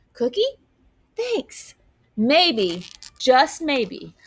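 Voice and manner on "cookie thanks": high, small voices; childlike voices. Acted. Kids sharing a cookie, high and soft. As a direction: offer and accept shyly.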